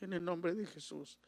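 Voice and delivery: mostly whispered, soft prayer; wife quietly praying for the family; hushed prayer. Retold or imitated. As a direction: pray under your breath like the wife did. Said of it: "Y ahí está".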